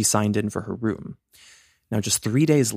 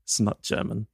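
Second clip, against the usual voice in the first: imitating a German woman's correction in German accent; lightly put-on German accent. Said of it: "corrected by a German person".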